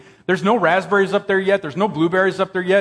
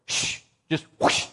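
whoosh sound; 6 dB quieter than usual. Retold or imitated. Imitating bear grabbing cooler.

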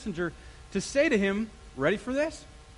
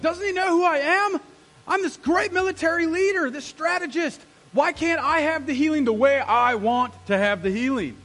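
indignant, self-important rant, much higher and louder; mad Naaman; 8 semitones higher, 8 dB louder than usual. Acted. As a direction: play the outraged big shot demanding his due. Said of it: "And so he's mad".

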